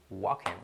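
"walking" with silent L, like "waken"; low, soft "walking" as "WAH-ken"; native pronunciation. Shown as a demonstration. Teacher showing how natives say it, native pronunciation. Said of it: "o sea, lo pronuncian".

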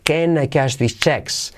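slow, stretched-out model reading; slow repetition. Shown as a demonstration. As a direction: draw each word out for repeating.

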